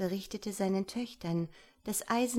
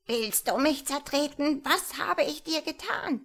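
high, indignant little-man cry; tiny man shouting; 7 semitones higher, 4 dB louder than usual. Acted. Tiny man to the girl nearly treading on him, high outraged cry. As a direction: squeal in protest as a tiny creature. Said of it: "schrie aus Leibeskräften".

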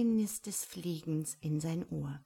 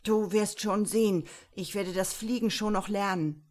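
put-on furious defiant young monk; angry; 3 semitones higher, 6 dB louder than usual. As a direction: snap back defiantly, loud. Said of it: "blickte die Katze wütend an".

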